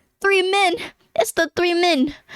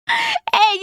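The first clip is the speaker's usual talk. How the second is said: a sudden squeaky yelp way up high. Real, not acted. Gamer catching the scammer on screen, yelping high. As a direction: yelp like you caught someone red-handed.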